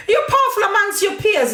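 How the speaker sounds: high, laughing put-down. Real, not acted. Mocking a critic's power through real laughter. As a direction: laugh the words out in scorn.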